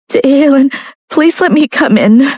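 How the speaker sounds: high, pleading and shivering from cold; begging Lucia. A narrator's reading. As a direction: beg him to let you in. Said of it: "Lucia begged".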